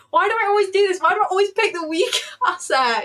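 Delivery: high, loud, exasperated laugh-whine; laughing frustration. Real, not acted. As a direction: cry out at yourself, half laughing. Frustrated at her weak trade pick.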